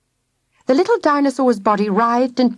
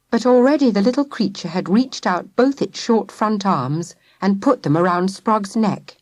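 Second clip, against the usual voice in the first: usual speaking voice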